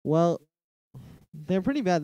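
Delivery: usual speaking voice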